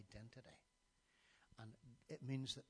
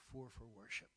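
ordinary delivery

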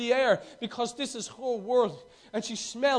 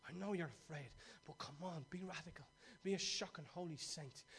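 soft, much lower, tender encouragement; God's gentle voice; 5 semitones lower, 12 dB quieter than usual. Retold or imitated. God gently encouraging a timid believer, soft and low. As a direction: speak as a gentle father coaxing a timid child.

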